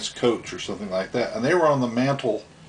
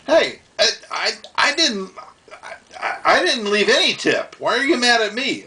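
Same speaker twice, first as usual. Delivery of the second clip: Kyle confused; 11 semitones higher than usual. Retold or imitated. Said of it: "the waiter guy and says".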